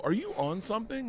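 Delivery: high rising question; own question. Retold or imitated. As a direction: ask it high and worried. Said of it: "And then at one point I go".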